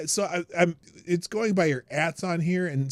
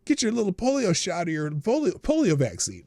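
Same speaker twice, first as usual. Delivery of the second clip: normal talking voice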